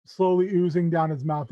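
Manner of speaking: ordinary talk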